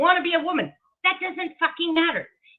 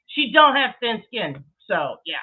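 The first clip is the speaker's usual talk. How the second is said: normal talking voice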